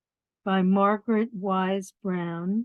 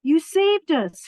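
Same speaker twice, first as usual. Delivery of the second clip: frogs' glad shout, higher and louder; 9 semitones higher, 7 dB louder than usual. Acted. Frogs shouting thanks to the toad, higher and louder. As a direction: call out the thanks in relief. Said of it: "shouted the frogs".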